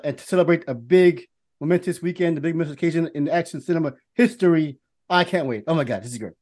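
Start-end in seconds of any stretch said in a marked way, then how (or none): none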